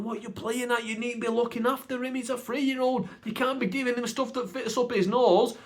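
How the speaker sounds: high-pitched mock scolding; dad blaming mum. Dad blaming mum over the nuts, high indignant scolding. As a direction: imitate an indignant parent telling off the other. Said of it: "Dad's blaming Mum, going".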